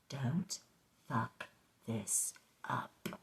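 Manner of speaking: very slow, word by word; quiet, heavily spaced self-warning; ramming it home. Acted. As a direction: space out each word to drum it in. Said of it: "say it twice to really ram it home".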